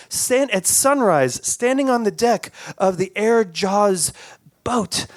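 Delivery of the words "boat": plain reading voice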